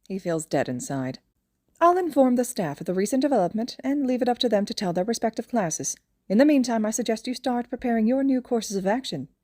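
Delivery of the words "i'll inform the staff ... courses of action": higher, fast, smug creature-principal voice; pleased little mastermind closing his trap; smug principal Nezu. A narrator's reading. Nezu wraps up cheerfully.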